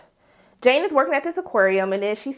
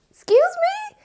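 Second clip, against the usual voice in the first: mock-incredulous high squeal; slower than usual. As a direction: act out a high-pitched, offended double take. Retold indignant reaction to a request.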